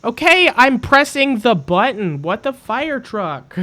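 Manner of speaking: high, loud exasperated shout; real rage. Real, not acted. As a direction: yell it out in frustration. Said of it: "So much rage for one person".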